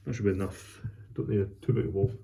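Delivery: ordinary talk, nothing marked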